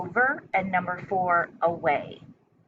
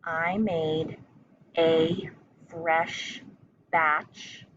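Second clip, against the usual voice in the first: chunked into short phrases with pauses between; teacher dictating a sentence; slow dictation; much slower than usual. Shown as a demonstration. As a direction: dictate it piece by piece for young spellers. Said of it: "The first part of the sentence is".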